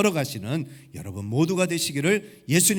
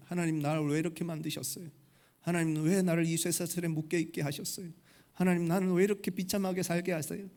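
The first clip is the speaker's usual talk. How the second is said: softer, mildly plaintive questioning of God; tearful complaint; 3 semitones higher, 6 dB quieter than usual. Acted. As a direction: lightly act a hurt elephant's lament. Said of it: "눈물을 뚝뚝 흘리면서 하나님을 원망하는 거예요". (나직하게 하소연하며 원망하듯)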